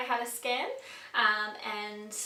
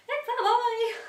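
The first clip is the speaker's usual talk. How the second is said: high, loud squeal of joy; joyful squeal; 13 semitones higher, 8 dB louder, slower than usual. Real, not acted. Announcing the baby's gender, joyful squeal. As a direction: squeal it happily.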